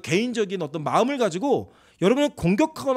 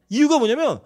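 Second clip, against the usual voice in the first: ordinary delivery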